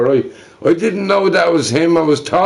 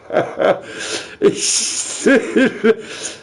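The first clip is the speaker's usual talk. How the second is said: breathy laugh; 8 semitones higher, 3 dB quieter than usual. Real, not acted. Laughs after plate rant.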